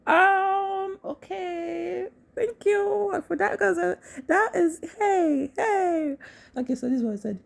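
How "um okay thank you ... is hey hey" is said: high, drawn-out stunned reaction; awkward high-pitched stammer, stretching the words; frozen, taken aback. Retold or imitated. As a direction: act out being stopped short, stalling in a high voice. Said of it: "that just made me freeze".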